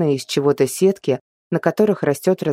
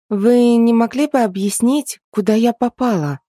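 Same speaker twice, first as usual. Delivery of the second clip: put-on young girl voice; 7 semitones higher, 3 dB louder than usual. A narrator's reading. Heroine voiced in dialogue.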